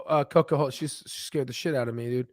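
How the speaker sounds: usual speaking voice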